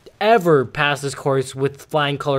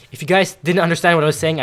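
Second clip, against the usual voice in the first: ordinary delivery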